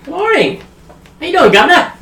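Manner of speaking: high, loud Cockney-style hail; over-the-top greeting. Acted. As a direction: boom out a cheery hello far too loudly. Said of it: "you can tone it down a bit".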